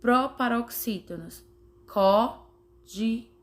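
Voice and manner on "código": "Código" slowly split as Có, di, go; syllable by syllable. Shown as a demonstration. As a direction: count out "Código" with a pause after each syllable. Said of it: "Sempre enumere".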